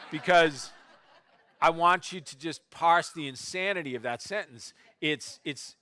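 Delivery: ordinary talk, nothing marked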